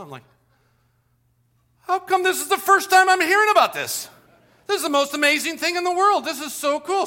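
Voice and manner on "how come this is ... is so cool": put-on higher and louder, thrilled child; childlike amazement. Boy hearing of the tooth fairy, higher and thrilled. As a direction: gush like a kid who just heard magic.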